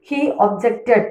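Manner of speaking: objected with the weight on JECT; light ob, stressed ject in objected; verb stress, second syllable. Shown as a demonstration. Teacher repeats the verb for learners, objected stressed on JECT. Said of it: "ഒബ്ജക്റ്റിൻ്റെ അടുത്താണ് stress വരുന്നത്".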